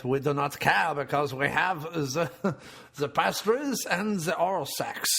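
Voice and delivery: hammed-up French accent. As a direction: boast in mock French. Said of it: "I'm French".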